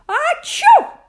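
high, loud sneezing bear; a big sudden achoo; loud sneeze. Acted. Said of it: "Someone sneezed".

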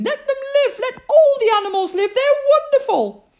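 high, loud and fast joyful plea; queen pleading for all the animals, high and loud; passionate plea. Acted. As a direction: beg for the animals with delight. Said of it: "cried the Queen".

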